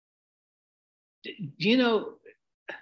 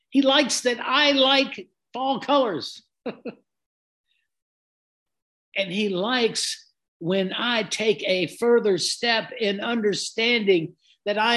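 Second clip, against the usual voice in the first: fervent preaching; 4 semitones higher than usual. Acted. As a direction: preach it with rising fervour to the congregation. Preaching builds to full force.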